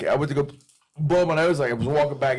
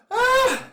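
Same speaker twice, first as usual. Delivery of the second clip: sharp, very high cry of pain; pained yelp; 5 dB louder than usual. Real, not acted. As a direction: yelp from the burn. Burning mouth from hot food.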